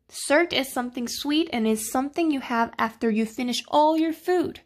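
normal talking voice

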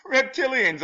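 high squeal of scorn; scoffing squeal. Real, not acted. Vlogger squealing in scorn at reptilian believers. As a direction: squeak it out in disbelief.

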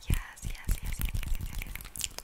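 rhythmic repeated whisper; asmr word repetition; whispered trigger words. Acted.

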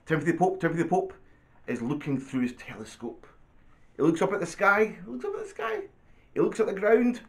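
animated storybook reading, lifting at phrase ends; reading to children. Acted. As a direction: read it out playfully for a small child. Reads picture book aloud.

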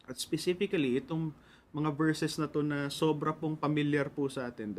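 ordinary delivery

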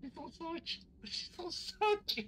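high, slowing panicked repeat; panicking gamer. Real, not acted. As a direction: wail in disbelief at the horde. Five monsters appear.

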